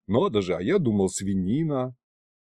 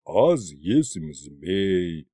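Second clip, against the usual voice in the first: slurred dragon; 7 semitones lower, much slower than usual. A narrator's reading.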